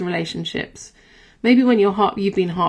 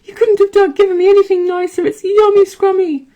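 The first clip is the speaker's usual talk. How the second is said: much higher and louder, a gushing little-dog voice; over-the-top high pet voice gushing about dinner; squeaky delighted pet; 13 semitones higher, 11 dB louder than usual. Pretend. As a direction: squeal it like a thrilled puppy thanking its owner. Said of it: "they'd be sort of like".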